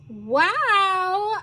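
high, drawn-out wonder; admiring wow. Acted. Girl sees mountains from the airport, admiring. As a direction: let it swell with awe. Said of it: "admiring the view".

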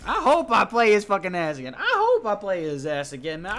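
loud heated rant; angry outburst. Real, not acted. Gamer vowing revenge on a toxic teammate, loud angry rant. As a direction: let the anger burst out loud. Raging about the teammate after the match.

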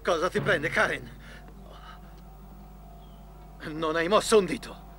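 loud, high, furious outburst; angry shouting. Acted. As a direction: snap at her in a rage. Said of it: "sono stato così brusco".

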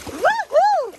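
high whooping woo-hoo; excited cheer. Real, not acted. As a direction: cheer him on. Cheering dog.

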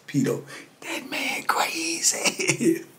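slow, breathless whisper through a laugh; amazed whisper. Retold or imitated. Telling Pito about the old man in a slow whisper. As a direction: hush it like a secret you can't believe.

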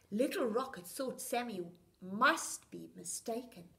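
ordinary delivery, nothing marked

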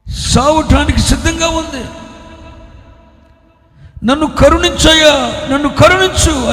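building from a high plea to a full shout; crying out. Acted. Mother crying after the silent Lord, building to a shout. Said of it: "అని కేకలు వేస్తుండే".

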